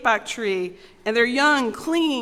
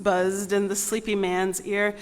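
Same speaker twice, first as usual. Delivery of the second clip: usual speaking voice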